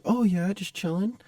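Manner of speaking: high, drawn-out echoing reply; high-pitched mock voice; timid stranger. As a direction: mimic the awkward guy slowly, up high. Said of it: "talking like this".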